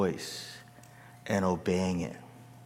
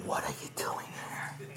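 soft unvoiced whisper; whispered God voice; 14 dB quieter than usual. Preacher imitates God questioning Elijah in a whisper. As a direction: breathe the question like a hushed secret. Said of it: "And the voice of God goes".

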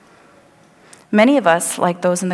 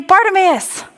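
rebuking; 6 semitones higher than usual. Retold or imitated. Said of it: "They're saying".

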